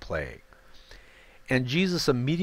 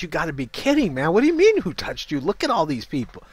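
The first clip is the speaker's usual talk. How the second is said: higher, exasperated disbelief; incredulous disciples; 6 semitones higher, 4 dB louder, faster than usual. Acted. Disciples scoff at Jesus amid the crowd, incredulous. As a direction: scoff like it's obvious.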